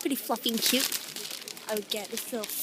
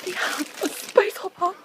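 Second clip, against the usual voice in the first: loud and high, thrilled; excited shout; 8 semitones higher, 5 dB louder than usual. Real, not acted. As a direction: call it out with excitement. Excited shout about space hopper.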